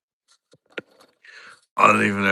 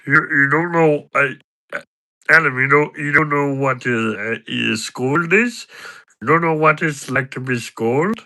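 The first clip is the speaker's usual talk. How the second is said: higher, halting character voice rising into questions; incredulous impression, stumbling over the words; Patter; 3 semitones higher than usual. Character presses his mate about scolding, raised stammering impression. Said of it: "I can do an impression of Patter".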